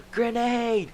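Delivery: high, loud cry of grenade; shout. Acted. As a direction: yell the warning. Soldier shouts alarm.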